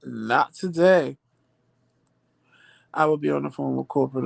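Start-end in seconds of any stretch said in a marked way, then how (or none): 0.0-1.2 drawn-out not today; slow, firm brush-off; slow sassy refusal. Retold or imitated. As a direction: take it slow and final. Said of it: "I was like, "Child".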